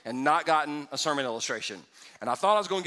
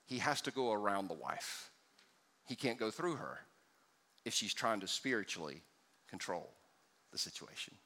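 much softer, lower, fading to near whisper; hushed; 8 semitones lower, 11 dB quieter than usual. Real, not acted. Pastor warns wives about control, hushed fading voice. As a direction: drop the voice to a hush. Sermon drops to hush.

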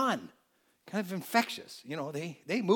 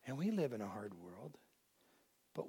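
whispered; 8 semitones lower, 13 dB quieter than usual. Real, not acted. Somber aside in sermon.

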